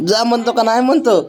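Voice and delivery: laughing and high, amused. Real, not acted. Father laughing as he asks the baby yes or no, laughing. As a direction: laugh through the question.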